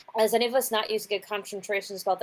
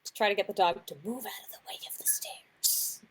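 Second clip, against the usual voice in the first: whispering; 6 dB quieter than usual. Real, not acted. Whispered aside to the DM.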